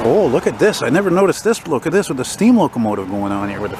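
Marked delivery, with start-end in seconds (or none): none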